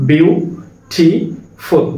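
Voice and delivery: বিউ, টি, ফুল with a clear pause after each; teacher's slow split of বিউ টি ফুল; syllable by syllable. Shown as a demonstration. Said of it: "ভিউ একটা syllable".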